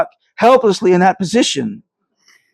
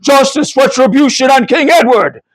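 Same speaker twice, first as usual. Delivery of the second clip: higher, rousing battle cry; rallying shout; 9 semitones higher, 3 dB louder than usual. A narrator's reading. A horseman rallies the others, shouting. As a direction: call it out like a soldier rallying men. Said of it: "shouted another".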